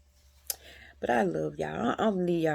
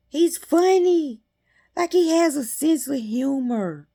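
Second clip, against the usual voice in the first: high, loud burst of delight; 8 semitones higher, 8 dB louder than usual. Real, not acted. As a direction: gush about how funny he is. Delighted by God's humour.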